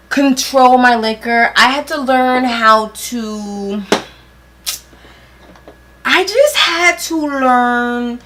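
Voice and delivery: loud, slow tipsy rambling. Real, not acted. Loud tipsy storytelling about drinking.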